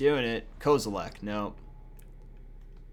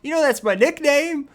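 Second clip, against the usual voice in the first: a hint of a higher, louder show-off voice; bragging persona; 10 semitones higher, 7 dB louder than usual. Hyping his [name] nickname to viewers, lightly hammed-up. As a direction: puff up like a cool nickname owner. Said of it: "I'm [name]".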